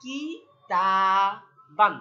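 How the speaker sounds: "কি", "তা", "বান" separated by long pauses; syllable-by-syllable reading. Shown as a demonstration. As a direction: spell out "কি তা বান" one syllable at a time. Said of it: "তাহলে দেখি আমরা".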